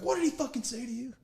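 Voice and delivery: high-pitched replay, volume held back; mock coach yell. Player replaying Vittorio's question to him, mild mock yell. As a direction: demand it as the riled coach, only half yelled.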